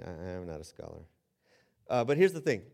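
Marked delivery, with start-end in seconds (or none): 0.0-1.0 self-mocking. Real, not acted. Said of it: "just kidding".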